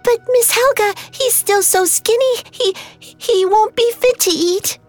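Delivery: put-on very high little-girl pleading; frightened stammer. A narrator's reading. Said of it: "she stammered".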